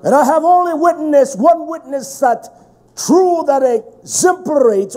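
loud, high, driving sermon; impassioned preaching. Real, not acted. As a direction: preach at full force, hammering each phrase. Preaches at full force.

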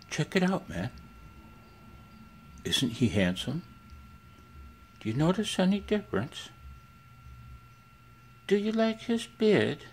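high, sing-song pet voice; high baby talk. Pretend. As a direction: coo at the dog in a high voice. Showing off the dog.

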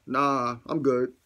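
ordinary delivery

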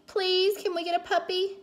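put-on high-pitched child's plea; pleading, pitched well up; begging. A narrator's reading. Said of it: "begged Cassie".

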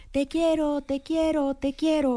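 light mock echo; cave echo. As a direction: imitate the cave answering back. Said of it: "empezó a oír".

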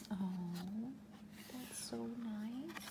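very soft, gentle "so nice"; tender soft aside. Real, not acted. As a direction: comfort the listener quietly.

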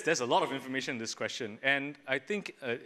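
ordinary talk

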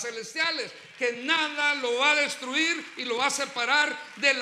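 loud, driving sermon cadence; impassioned pulpit exhortation, sustained and loud; fervent preaching. Real, not acted. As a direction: preach at full force, hammering each phrase. Preaches at full force.

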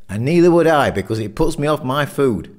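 loud, high-pitched protest; emphatic refusal. A narrator's reading. Jeff refuses to eat innards.

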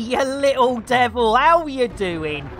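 big drawn-out, high shout of greeting; loud, high, long-held call; hailing a viewer. Real, not acted. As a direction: holler the name in welcome. Greets arriving viewer Tony by name.

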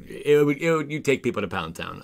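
tripping over the first words; stuttering, overwhelmed. Real, not acted. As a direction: stumble into it excitedly, restarting as you go. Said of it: "I'm stuttering on my words".

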